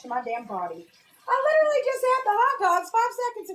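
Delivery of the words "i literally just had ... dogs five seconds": high frazzled complaint; baffled and fed up; real kitchen exasperation; exasperated. Real, not acted. Frustrated search.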